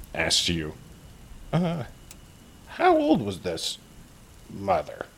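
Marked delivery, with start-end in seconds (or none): 1.5-5.1 high, slow goblin question; sly and curious; creepy goblin. Acted. Goblin asking the hero about the mother, high and slow.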